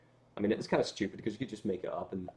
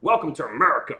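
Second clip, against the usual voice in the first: loud, high hammed-up Texan welcome; Texan officer; 6 semitones higher, 11 dB louder than usual. The officer stamps the passport, hammed-up loud Texan welcome. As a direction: boom it out like a cowboy border guard.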